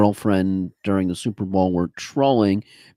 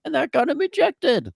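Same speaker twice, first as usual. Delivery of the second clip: squeaky high punchline; high squeak. Real, not acted. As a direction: squeal the last words. Sudden falsetto punchline.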